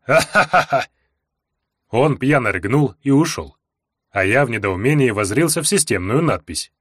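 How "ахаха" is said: booming laugh. Acted. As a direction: laugh big and drunk. Barbarian laughs again.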